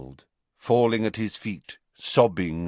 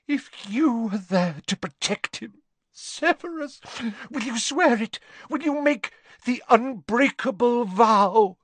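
clutch at him with words; gasped plea; 14 semitones higher than usual. A narrator's reading. Narcissa begging Snape to do it instead, high and frantic.

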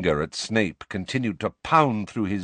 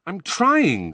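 frustrated outburst, raised pitch; angry; 9 semitones higher, 4 dB louder than usual. Acted. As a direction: snap back in frustration. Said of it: "said Harry angrily".

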